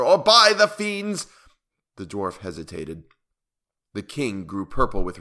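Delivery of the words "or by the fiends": high, loud, drawn-out oath; shouted threat. A narrator's reading. Enraged king threatening the dwarf, slow high shout. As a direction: stretch the oath into a cry. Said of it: "shouted the monster".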